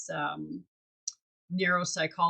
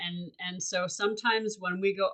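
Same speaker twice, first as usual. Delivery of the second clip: normal talking voice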